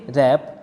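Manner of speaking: रैप starting straight on R; silent W. Shown as a demonstration. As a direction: say रैप without any W sound. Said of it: "डब्ल्यू silent रहेगा".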